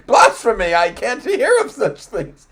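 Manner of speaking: high, loud cry of blasphemy; hammed-up scandalized outrage. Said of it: "And he's like".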